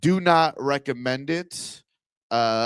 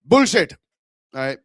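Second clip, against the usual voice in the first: Indian accent; 7 dB louder than usual. Shown as a demonstration. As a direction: punch out "Bullshit" in an Indian accent. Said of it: "that certain Indian accent".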